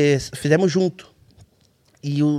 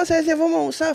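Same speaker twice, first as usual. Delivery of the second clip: imitando um amigo que chama alto e animado; chamado alegre e agudo; 12 semitones higher, faster than usual. Retold or imitated. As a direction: convite cantado, bem mais agudo. Amigos chamando para almoçar. (amigo da equipe convidando o César para almoçar, voz aguda e animada)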